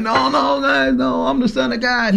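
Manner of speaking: high, mock-whiny protest; mock whining. Imagined Jesus whining on the way to the cross, hammed-up. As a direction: pretend to beg your way out, half-hearted. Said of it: "whining and crying and kicking".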